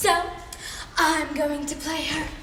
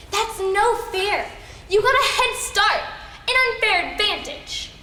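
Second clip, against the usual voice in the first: loud, higher complaint; indignant protest; 4 semitones higher, 3 dB louder than usual. Acted. Rival objecting to a secret script, indignant protest. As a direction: protest it, voice rising.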